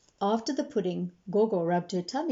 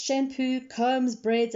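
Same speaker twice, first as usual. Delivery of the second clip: plain reading voice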